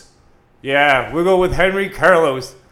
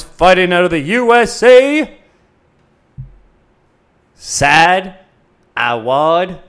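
ring announcer; 5 dB louder than usual. Acted.

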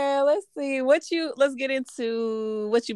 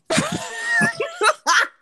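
spooky voice; 4 dB louder than usual. Pretend. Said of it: "That's my Halloween spooky voice".

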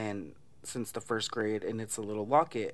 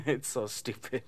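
ordinary talk, nothing marked

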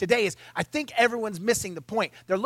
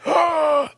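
mock gasp; 7 semitones higher, 4 dB louder than usual. Mock offence at Buttercup.

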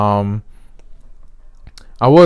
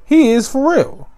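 much higher, amazed repeat; excited emphasis; 14 semitones higher than usual. Real, not acted. Host hyping Kyle Trask, amazed. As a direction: lift it up in awe. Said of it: "restated much higher".

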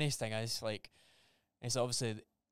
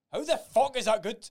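higher, louder incredulous outburst; hammed-up disbelief at a small raise; mock outrage; 9 semitones higher, 9 dB louder than usual. Retelling how he reacted to a colleague's raise, outraged.

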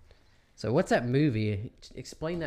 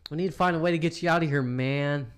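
stoner drawl; 4 semitones higher, 5 dB louder than usual. Retold or imitated. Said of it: "Gotta have that drawl".

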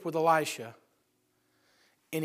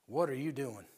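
stern quiet question; 5 semitones lower, 8 dB quieter than usual. Acted. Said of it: "And the Lord says".

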